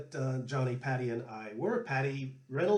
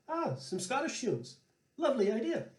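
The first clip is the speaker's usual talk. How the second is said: much higher, sweetly teasing; sly old Paddy; 10 semitones higher than usual. Retold or imitated. Paddy answering Johnny's Scottish music request, sly high voice. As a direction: agree with a knowing twinkle. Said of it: "looks at him and says".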